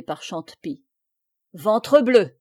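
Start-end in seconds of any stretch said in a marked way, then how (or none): 1.5-2.4 loud, startled curse; loud exclamation of surprise; loud oath. A narrator's reading. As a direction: burst out with the oath. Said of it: "s'écria Blaise".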